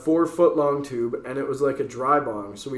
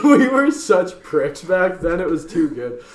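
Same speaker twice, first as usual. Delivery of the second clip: ordinary talk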